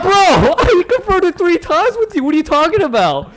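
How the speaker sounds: high, loud laughing outburst; incredulous protest. Retold or imitated. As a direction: retell it as a squealing, indignant objection. Acted protest at false accusation.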